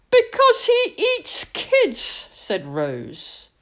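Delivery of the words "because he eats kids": put-on much higher, a frightened child's protest; high child, wide-eyed; little girl's voice. Little girl voiced, scared.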